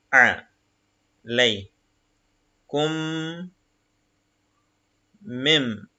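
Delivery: each Arabic syllable said apart, slowly; syllable reading. Shown as a demonstration. Teacher reading Arabic syllables to the class, slow and separated. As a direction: spell the word out syllable by syllable. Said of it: "à cause du shadda qui vient ici".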